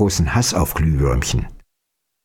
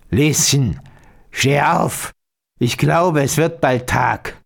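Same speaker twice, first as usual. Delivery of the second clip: put-on old witch calling; 3 semitones higher, slower than usual. A narrator's reading. Old witch calling the girl to get up, lightly croned. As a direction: call out like a waking old witch. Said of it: "Sie rief".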